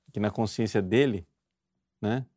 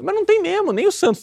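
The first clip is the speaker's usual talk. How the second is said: emphatic outburst; 6 dB louder, faster than usual. Real, not acted. Preacher rebuts an excuse.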